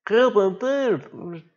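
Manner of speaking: high, slow, drawn-out whimper; whiny tired plea. Retold or imitated. A tired diver whining to the boat crew, high and slow. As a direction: stretch it out like an exhausted man pleading. Imitating Darius again.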